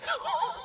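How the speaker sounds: soft, very high-pitched laugh; helpless high giggle. Real, not acted. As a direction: let out a quiet, squealing laugh. Laughs at his future age.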